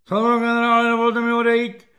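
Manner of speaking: high, loud parade-ground address; chest-out military request. A narrator's reading. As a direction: shout it formally, standing to attention. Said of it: "vypjal prsa Kefalín". (hlasité vojenské hlášení)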